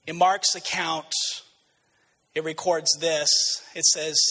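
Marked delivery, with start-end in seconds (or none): none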